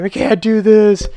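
high, loud, drawn-out wail; mock despairing cry. Recruits falling out on the hill, mock despair. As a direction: imitate an exhausted recruit giving up.

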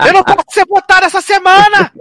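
very high put-on protest; mock panic. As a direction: play a contestant pleading immunity, hammed up. Joking parody of protest.

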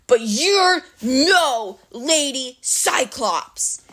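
slow, emphatic monster anger; angry growl. A narrator's reading. Cyclops unmasks his false bride, slow anger. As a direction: drag out each word as the anger breaks through. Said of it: "trying to contain his anger".

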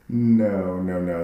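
ordinary delivery

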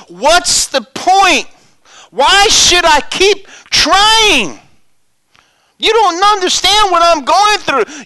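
high, loud and very slow wail; voicing a hopeless churchgoer, loud and high; despairing complainer.

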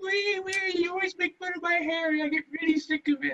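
high whiny imitation of a friend; mock him in a high whine. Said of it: "he's like".

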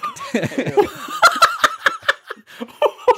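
squealing high-pitched laughter; helpless high laugh; high giggle fit. Real, not acted. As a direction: break into a shrieking giggle. Cracking up at own joke.

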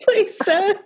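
high, thin laughing plea to a master; a feeble servant begging, sir; frail pleading voice. Retold or imitated. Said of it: "this frail voice".

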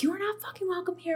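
high-pitched mock scream without the volume; retold screaming. Woman retelling how they banished a presence, high mock scream. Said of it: "could hear me outside of my house screaming".

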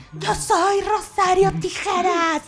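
high, slow menacing villain boast. Retold or imitated. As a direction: play a hammy telenovela villain. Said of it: "Y termina así de".

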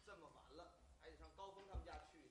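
muttering to himself. Acted. Acting out the late walk to Gao Feng's house: fast quiet muttering. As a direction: grumble it quietly to yourself on the way.

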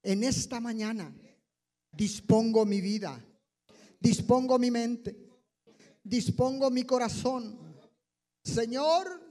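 earnest prayer, phrase by phrase; led prayer. Acted. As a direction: lead the church in fervent prayer. Leads congregation in prayer. (oración guiada y ferviente)

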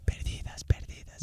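fading whispered echo of the word; mock echo fading into a whisper. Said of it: "ese video de Estamos Perdidas".

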